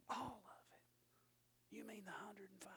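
breathless whispered disbelief; shocked whisper. Retold or imitated. Preacher answers God in a stunned whisper. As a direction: whisper it like you can't believe it.

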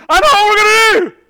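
high, loud eager shout; excited outburst. Acted. Peter shouting his idea on the mountain. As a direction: blurt it out with wild excitement.

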